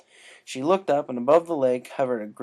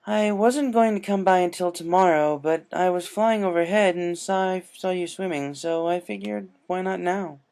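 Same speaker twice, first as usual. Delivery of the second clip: higher, gentle royal voice; Princess Luna; 7 semitones higher, 4 dB louder than usual. Acted. Luna explaining her visit to Rainbow, gentle and higher. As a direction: explain kindly, pitched up.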